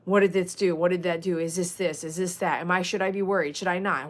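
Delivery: ordinary talk, nothing marked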